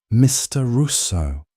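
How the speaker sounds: slow, icy and controlled; cold calm. Acted. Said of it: "her voice dropping to a terrifyingly calm register".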